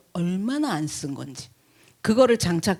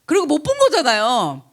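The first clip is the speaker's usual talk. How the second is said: normal talking voice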